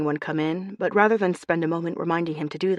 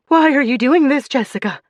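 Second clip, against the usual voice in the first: high, loud frightened plea; 8 semitones higher, 8 dB louder than usual. A narrator's reading. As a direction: beg in terror. Hostage pleads at gunpoint.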